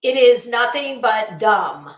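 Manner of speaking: ordinary talk, nothing marked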